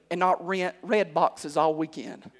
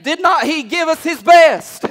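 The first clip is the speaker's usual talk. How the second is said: higher, louder, slow impassioned cry to the Lord; shouted prayer; 7 semitones higher, 9 dB louder than usual. Retold or imitated. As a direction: plead with God at full volume. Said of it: "you just say".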